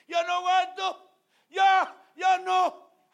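high, loud, slow cry of pain; anguished cry. Acted. Voicing tortured believer crying out. (grito de dolor agudo y fuerte)

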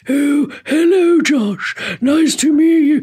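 high, slow, stately politician caricature; Margaret Thatcher. Acted. Impressionist greeting Josh as Thatcher, slow grand put-on voice. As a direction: draw the vowels out grandly and slowly. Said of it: "doing her Margaret Thatcher impression".

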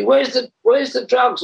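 high, slow demanding quote; high interrogating mimicry; mimicking the cop. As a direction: drag out the demand, pitched high. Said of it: "he was saying to me".

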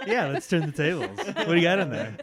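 laughing. Real, not acted. Laughing while teasing friend.

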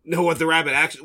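ordinary delivery

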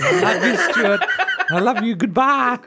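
high, loud doting mum. Pretend. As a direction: gush like a loving mum. Mum role signs off.

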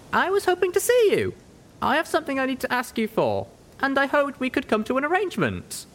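put-on high young mare, earnest and polite; high, earnest young pony asking a favour; Little Pip's voice. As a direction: ask the griffin a favour, hopeful. Narrator voices Little Pip.

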